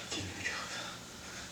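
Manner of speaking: breathless dying whisper; strained whisper. Acted. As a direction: whisper it with no strength left. Dying Hamlet struggles for cup.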